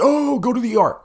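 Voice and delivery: high, whiny mock panic; mocking overreaction. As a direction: squeal it like a hypochondriac. Mocking people who overreact.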